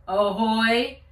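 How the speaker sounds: loud long booming call; booming hail. A narrator's reading. Policeman hailing again, booming shout. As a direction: boom it across the river. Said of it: "The booming voice came again".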